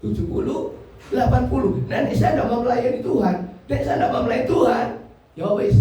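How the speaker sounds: ordinary talk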